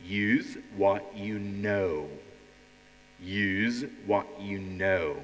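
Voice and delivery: slow, low emphatic slogan. Shown as a demonstration. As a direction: spell out the slogan slowly. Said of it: "the slogan of our second part".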